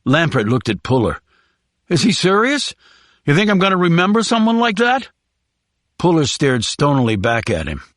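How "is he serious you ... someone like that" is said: high, fast and incredulous; whiny prisoner voice. Acted. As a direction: rush it, scared and scoffing.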